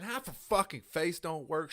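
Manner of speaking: ordinary talk, nothing marked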